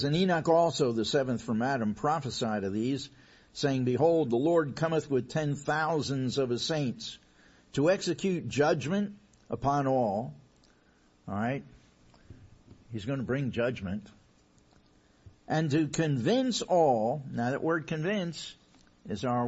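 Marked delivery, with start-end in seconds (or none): 0.0-10.3 loud, ringing scripture reading; preaching scripture. Acted. Preacher reading Jude to his church, loud and ringing. 15.5-17.2 loud, ringing scripture reading; preaching scripture. Acted. Preacher reading Jude to his church, loud and ringing.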